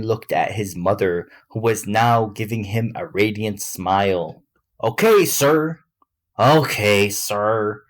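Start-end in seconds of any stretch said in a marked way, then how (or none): 4.8-5.8 high, loud "Okay, sir"; raised reply. A narrator's reading. Son answering his father, high and loud. First take of repeated line. 6.4-7.8 resigned sigh at usual pitch. A narrator's reading. Son giving in to his father, sighing. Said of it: "Bradley sighed".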